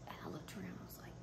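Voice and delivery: breathy whisper with a gasp; hushed whisper. Real, not acted. Reliving the moment for viewers, hushed whisper. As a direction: whisper it in awe.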